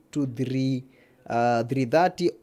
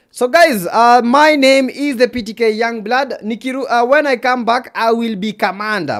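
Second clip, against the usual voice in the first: high, loud hype-host introduction; loud announcing; 9 semitones higher, 10 dB louder than usual. Real, not acted. Streamer announcing their gamer name to viewers, loud and high. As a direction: project it big, well above normal pitch.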